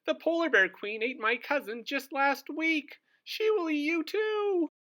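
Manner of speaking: a seal warning of danger; high cartoon seal warning. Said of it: "barked Seely Sally".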